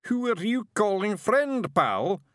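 picking a fight with the barman; drunken roar. Acted. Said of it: "roared the figure".

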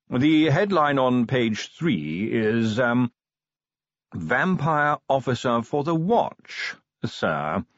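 hesitant butler. Acted. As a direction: read the headline out gingerly, drawing it out. Voices butler in story.

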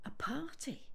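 whispered, unsure question; puzzled whisper. Acted. Gran whispering, puzzled by talk of a party. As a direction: breathe it out as a bewildered question.

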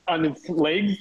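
ordinary talk, nothing marked

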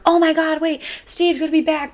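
high-pitched fan excitement; excited mock outburst; retold excitement. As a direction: gush in a high voice, re-enacting the thrill. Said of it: "we were excited when we saw it".

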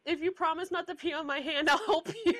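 lightly voiced receptionist; front desk lady. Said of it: "the lady at the front desk was like".

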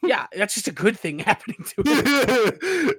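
breaking into laughter, pitch climbing; laughing through the words; real laughter. Real, not acted. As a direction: start amused and crack up by the end. Laughing at co-host's joke.

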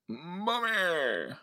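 high, soft drawn-out wail; mock cry for mommy. Acting out a character calling Mommy, mock wail. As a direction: pretend child calling for a parent. Voicing a character crying for mother.

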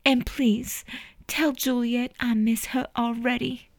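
trembling plea through near tears; lip quivering. A narrator's reading. Mother sending love to Juliet, tearful and soft. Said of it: "Her lip quivered".

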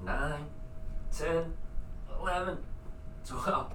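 high, straining count; strained counting. Real, not acted. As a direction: count through the burn. Counting through push-ups.

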